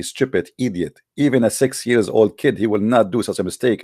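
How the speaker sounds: ordinary delivery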